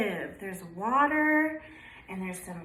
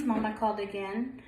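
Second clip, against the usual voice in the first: plain reading voice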